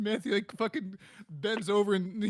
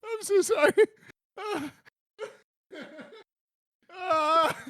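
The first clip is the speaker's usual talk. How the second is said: high, tearful real laughter; helpless laughing; 7 semitones higher, 4 dB quieter than usual. Real, not acted. Comic apologising to friends, tearful with laughter. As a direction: apologise while crying with laughter.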